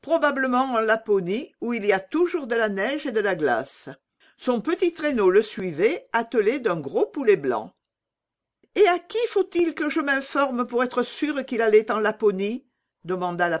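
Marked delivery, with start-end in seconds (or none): 0.1-7.7 higher-pitched bird giving news; lightly raised, matter-of-fact pigeon voice; talking pigeon. Acted. Pigeon telling Gerda where the sleigh went, raised and informative. 8.8-12.6 put-on high little-girl question; eager little Gerda. Gerda seeking proof from the pigeons, high and eager.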